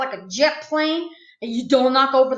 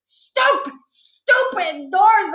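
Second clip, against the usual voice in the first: very high, frustrated holler; 6 semitones higher, 3 dB louder, much slower than usual. A narrator's reading. Child facing locked doors, hollering. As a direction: holler it, stretching the words out. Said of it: "I hollered".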